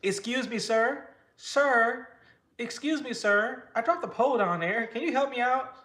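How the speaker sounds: high, loud mock-polite begging; hammed-up polite plea for help. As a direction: play the stranded fisherman asking politely. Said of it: "D J was like".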